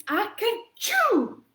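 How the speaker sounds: high, loud, stretched achoo; the grand finale sneeze; biggest fake sneeze. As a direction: let the final sneeze explode. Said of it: "a pretty dramatic reading".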